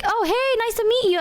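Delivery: very high, gushing greeting; Junie. Retold or imitated. Junie greets Michi as if new, high mock friendliness. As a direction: imitate an oblivious friend saying hello up high. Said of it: "And she's like".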